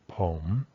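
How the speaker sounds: "pom" alone, low then rising; isolated rising tone. Shown as a demonstration. Teacher recaps the traditional model, "pom" low and rising. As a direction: start "pom" low and curve it up. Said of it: "in the traditional model".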